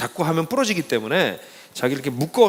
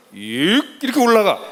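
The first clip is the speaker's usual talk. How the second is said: mock effort; 4 semitones higher, 4 dB louder, slower than usual. Acting out trainer's pull-up.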